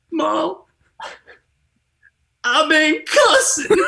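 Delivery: put-on high, slow, wailing kid confessing; a twelve-year-old bawling out his guilt to mom; tearful confession. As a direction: voice cracking high as he admits cussing to his mom. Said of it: "I said".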